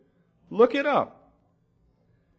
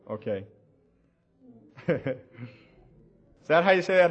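ordinary talk

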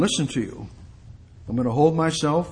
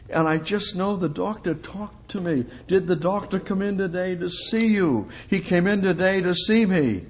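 contented patient; 5 semitones higher than usual. Acted. Patient telling of the doctor's visit, high and emphatic. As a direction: play the happily trusting patient, pitched up.

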